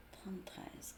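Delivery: whispered number, to herself; chuchoté. Real, not acted. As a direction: breathe the card number under her breath. Reading the card number to herself.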